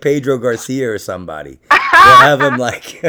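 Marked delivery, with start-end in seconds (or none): 1.7-3.1 excited laugh. Real, not acted. Excited plotting the prank.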